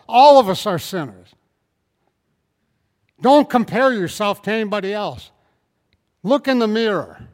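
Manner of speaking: loud, ringing pulpit preaching; loud fiery preacher; preaching at full force. Acted. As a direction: drive it home to the congregation.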